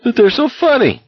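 high, helpless giggling words; high hysterical laughing through the words; near-weeping laughter. Acted. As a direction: laugh until it almost turns to tears. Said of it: "nearly weeping with laughter now".

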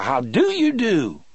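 high, slow taunting voice; mocking caricature voice. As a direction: drawl the greeting mockingly. Inmate mocks guard.

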